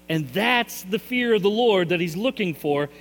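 usual speaking voice

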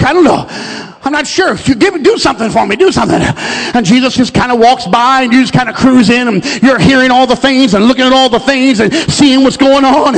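ordinary delivery

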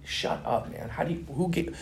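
stunned whisper; whispered disbelief. Retold or imitated. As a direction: whisper it, unable to believe the name. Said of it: "I'm like".

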